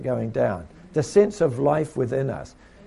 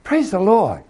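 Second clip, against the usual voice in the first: cheerful greeting; 5 semitones higher, 5 dB louder than usual. Retold or imitated. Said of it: "I go".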